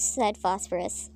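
ordinary delivery, nothing marked

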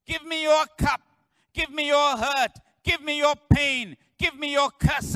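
loud, high preaching cry to the church; full-force preaching. Acted. Pastor to congregation, loud high preaching cry. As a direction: pour it out like a revival preacher.

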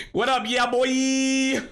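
loud, high hype greeting; hype shout. Real, not acted. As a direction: belt it out like a hype man. Greeting Timmy with a hype call.

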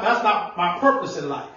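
ordinary talk

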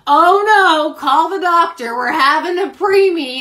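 high, loud mock alarm; teasing, hammed-up cry for a doctor; mocking husband's reaction. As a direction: overplay a husband's fake panic. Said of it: "this is Brock's reaction".

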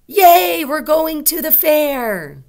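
loud, high-pitched cheer; excited exclamation. Shown as a demonstration. Teacher models strong emotion about going to the fair, excited. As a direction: whoop it out, thrilled, stretching the words. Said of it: "That would be an exclamation mark".

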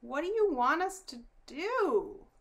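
put-on high, loud young cousin's question; piping up. A narrator's reading. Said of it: "Danny piped up first".

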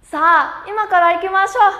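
rally everyone to go right now; strong invitation. Shown as a demonstration. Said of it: "Mời rủ mạnh mẽ nào".

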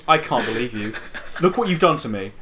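ordinary talk, nothing marked

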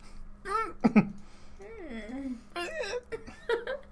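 high helpless giggle; helpless laugh. Real, not acted. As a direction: laugh until it almost cries. Laughing at own hiccups.

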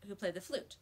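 usual speaking voice

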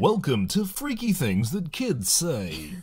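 hammed-up segment intro; mock show host. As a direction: present it like a spooky TV show. Announcing a segment title.

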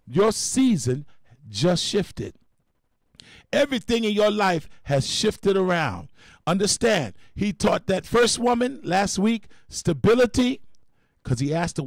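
normal talking voice

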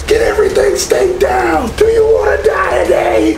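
Scoutmasters yelling. Retold or imitated. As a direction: bellow orders at the kids. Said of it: "Scoutmasters are yelling at us".

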